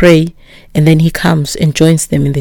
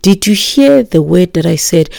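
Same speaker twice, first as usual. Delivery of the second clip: usual speaking voice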